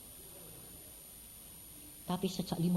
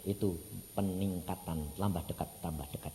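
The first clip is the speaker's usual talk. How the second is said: fading softly; 9 semitones lower, 6 dB quieter than usual. Real, not acted. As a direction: draw them in, quieter each time. Khotbah makin pelan. (makin pelan dan lirih)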